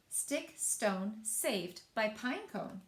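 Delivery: usual speaking voice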